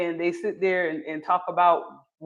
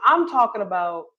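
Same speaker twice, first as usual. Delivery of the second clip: ordinary delivery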